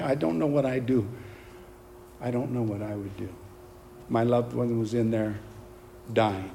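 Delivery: low, subdued and heavy-hearted; subdued grief. Real, not acted. Preacher imagining a loved one dying, grieved and subdued. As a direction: let the voice sink as if imagining the loss.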